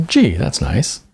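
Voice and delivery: plain reading voice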